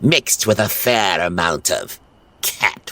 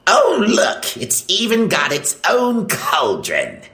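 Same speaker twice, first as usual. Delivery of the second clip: delighted villain. Acted. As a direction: burst out in giddy delight. Gargamel delighted by potty.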